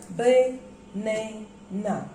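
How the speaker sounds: banana split slowly into ba, na, na; syllable by syllable. Shown as a demonstration. Teacher counting syllables for students, banana in three slow parts. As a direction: draw out banana with a gap between syllables. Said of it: "How many syllables are there?".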